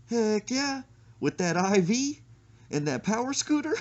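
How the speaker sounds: high, loud imitation of his eager yes; mock-eager friend cheering; eager mock reply. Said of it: "And he said".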